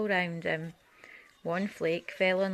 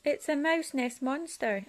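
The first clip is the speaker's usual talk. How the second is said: squeaky frightened mouse, higher; 7 semitones higher than usual. Acted. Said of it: "squeaked Little Mouse".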